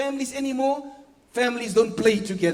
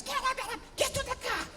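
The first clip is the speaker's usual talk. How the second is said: high, urgent "get up"; rushing parent chasing kids to the car; hurried parent call; 13 semitones higher, 4 dB quieter than usual. Retold or imitated. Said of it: "You, you, you dress".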